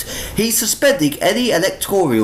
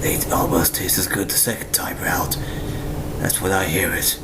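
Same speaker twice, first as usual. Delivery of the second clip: soft, hushed noir whisper; eerie whispered inner monologue; whispered narration; 11 semitones lower, 5 dB quieter than usual. A narrator's reading. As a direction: breathe the narration. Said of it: "we get more narration".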